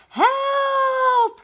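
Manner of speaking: very high shouted help; cry for help. Acted. Dish and spoon falling, very high shout. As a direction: scream for help from above. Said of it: "came a cry".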